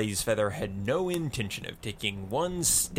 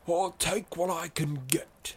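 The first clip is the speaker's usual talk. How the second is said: put-on higher-pitched old-timer character voice; old explorer's voice; 5 semitones higher than usual. A narrator's reading. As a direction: answer as the weathered old explorer. Reefer, the old explorer, answers.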